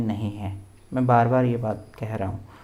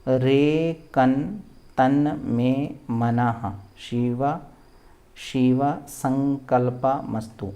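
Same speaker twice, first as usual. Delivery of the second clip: word-by-word demo. Shown as a demonstration. Teacher breaking the next mantra down, word by word. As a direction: sound out each piece for learners. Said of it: "चलिए अब इसको बोलते हैं".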